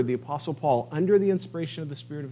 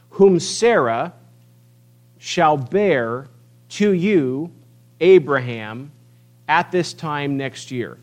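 much louder, slow and declaring; booming proclamation; 7 dB louder, slower than usual. Acted. Preacher voicing El Shaddai to the congregation, booming. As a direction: proclaim it like the Almighty.

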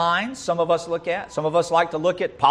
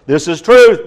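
louder, declaring it as fact; mock proclamation; 10 dB louder than usual. Preacher mimicking believers, loud mock declaration. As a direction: proclaim it smugly.

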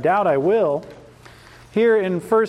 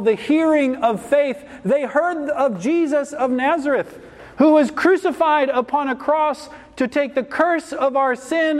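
raised-pitch, proclaiming sermon voice; emphatic preaching; 6 semitones higher than usual. Real, not acted. Preacher reminding converts of Christ crucified, emphatic preaching. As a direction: proclaim each word to the congregation.

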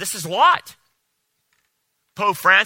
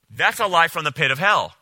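ordinary talk

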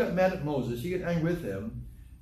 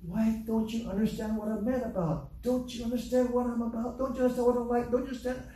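higher, exasperated pleading at his wife; a mock replay of a heated plea to his wife; pleading frustrated outburst; 6 semitones higher than usual. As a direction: plead to be understood, near tears. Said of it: "I got so mad once".

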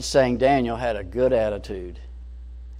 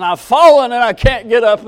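mock helpless cry; 9 semitones higher, 10 dB louder than usual. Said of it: "you're saying".

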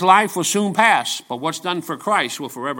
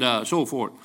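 ordinary talk

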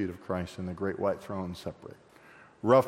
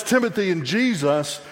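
ordinary talk, nothing marked

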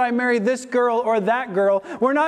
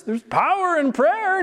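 high-pitched mimicking of a naive believer; mock piety; 6 semitones higher than usual. Preacher imitating people who trust in prayer words, mockingly pious. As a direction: parrot the saying in a raised, mocking voice.

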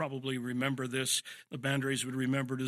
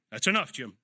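a firm, louder warning; hint of a stern father; firm dad command; 4 dB louder than usual. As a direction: lay down the law to the crying boy. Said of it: "the authority of Dad's voice".

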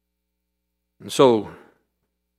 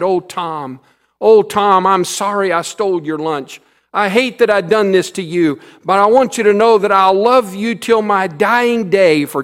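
tearful plea; 7 semitones higher, 7 dB louder than usual. A narrator's reading. Little boy clinging, tearful thanks.

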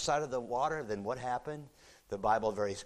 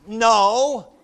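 1 shouted no; 11 semitones higher, 17 dB louder than usual. Acted. Disciples answering from the boat.

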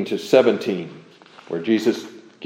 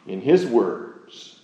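ordinary talk

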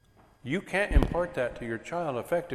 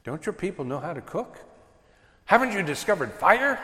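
mock incredulous jab; 4 semitones higher than usual. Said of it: "And I'm thinking".

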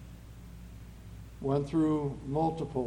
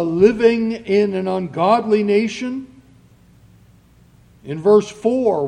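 normal talking voice

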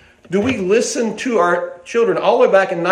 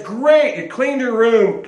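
higher, emphatic praise with a drawn-out finish; 3 semitones higher, slower than usual. Shown as a demonstration. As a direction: praise the result enthusiastically. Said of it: "Praise says".

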